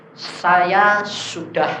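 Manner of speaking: very slow, drawn-out imitation of a visitor; imitated slow, weighty declaration; solemn claim. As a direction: stretch each word out as a grand announcement. Visiting shaman's grand claim, acted.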